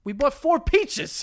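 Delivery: high, slow sarcastic jab; mocking. Real, not acted. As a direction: drag it out mockingly. Mocking his shopping claim.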